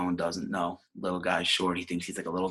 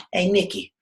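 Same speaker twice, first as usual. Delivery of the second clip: higher, louder mom calling out; Italian-American mom; 11 semitones higher, 7 dB louder than usual. Acted. Ma greets her son home from the pizzeria, acted mom voice. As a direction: call out higher as Nicky's ma. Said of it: "And his ma goes".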